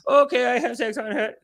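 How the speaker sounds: mock Indian accent; fake Indian accent. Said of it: "putting on a fake accent".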